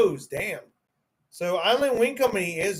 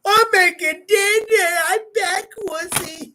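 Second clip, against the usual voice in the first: mock whiny voice; 11 semitones higher, 4 dB louder than usual. Mocking Lopez in high voice.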